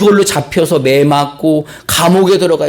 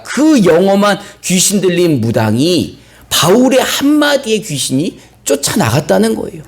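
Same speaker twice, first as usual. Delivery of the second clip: usual speaking voice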